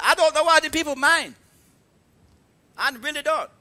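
put-on high, exasperated Korean accent; mad, broken Korean-English. Korean master complaining to Pastor Leon, broken Korean-English. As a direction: imitate a baffled, angry Korean instructor. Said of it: "here's what she was mad about".

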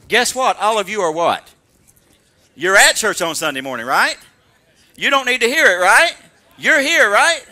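very loud, hammering rhetorical questions; scolding lecture. Shown as a demonstration. Pastor lecturing those already at church, loud scolding. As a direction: chew out the congregation at full volume. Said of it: "It's sort of like me doing this".

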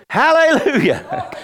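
loud, high, drawn-out praise; exultant shout. Real, not acted. Preacher praising God before the congregation, exultant shout. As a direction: shout it out long and high with a laugh in it.

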